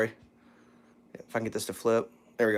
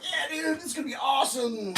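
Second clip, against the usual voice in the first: mock excitement; 9 semitones higher, 9 dB quieter than usual. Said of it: "I was kinda like".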